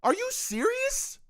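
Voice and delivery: can't believe what he sees; a fan's high-pitched "are you serious"; incredulous. Real, not acted. Punter's mistake, fan incredulous.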